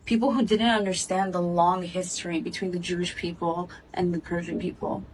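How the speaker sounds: ordinary talk, nothing marked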